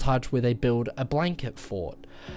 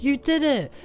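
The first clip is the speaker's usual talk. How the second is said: high squeal of joy; excited cheer; 5 dB louder than usual. Retold or imitated. Abby cheering as the table lifts, high excited squeal. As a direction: cheer like a delighted little girl.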